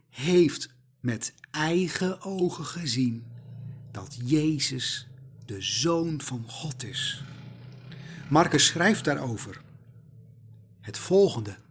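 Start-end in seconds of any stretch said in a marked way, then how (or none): 3.9-7.2 awed whisper. Real, not acted. Awed revelation of centurion's sight.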